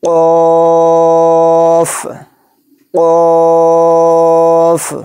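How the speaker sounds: قاف drawn out for three alif counts with a full, thick sound; قاف chanted long and thick; heavy stretched qaf. Shown as a demonstration. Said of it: "تین الف کی مقدار کھینچ کر پڑھیں گے اور اس کو پر یعنی موٹا".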